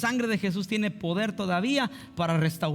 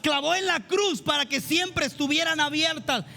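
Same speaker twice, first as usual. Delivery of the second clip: full-force preaching; 10 semitones higher than usual. Acted. Pastor urging the congregation to love Jesus, fervent preaching. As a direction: preach it out with fire, nearly shouting.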